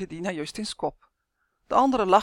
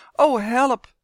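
loud, higher-pitched moan for help; woeful, raised plea; groaning; 4 semitones higher, 7 dB louder than usual. A narrator's reading. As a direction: groan it out in dismay. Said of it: "kreunde Justin".

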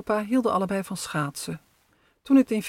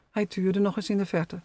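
ordinary delivery

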